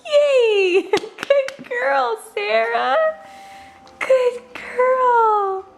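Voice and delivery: high, tearful cheering; overjoyed praise. Real, not acted. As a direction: cheer through happy tears. Baby walks, parent overjoyed.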